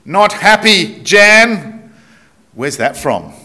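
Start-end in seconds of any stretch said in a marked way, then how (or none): 0.1-1.9 broad Australian, higher and louder; Aussie boss accent. Shown as a demonstration. Preacher showing off a broad Australian accent. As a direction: put on your best Australian accent. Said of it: "I try to do it my best accent".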